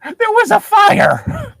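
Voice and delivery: high, slow, loud protest; squeaky outraged slow cry; exasperated cry. Acted. Exasperated defence in reading.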